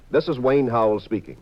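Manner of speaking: usual speaking voice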